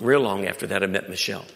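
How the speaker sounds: ordinary delivery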